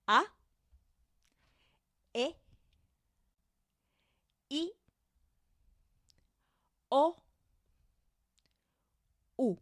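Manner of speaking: each vowel one short pure sound; Spanish vowels. Shown as a demonstration. Teacher models the five vowels for learners to repeat. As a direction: say A E I O U short and steady. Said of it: "it will always sound the same".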